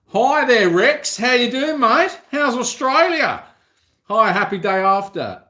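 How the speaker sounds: high, loud cheery hello to a viewer; greeting an Australian viewer, high and loud; excited greeting. Real, not acted. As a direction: call out a big happy welcome. Greeting viewer Rex on live.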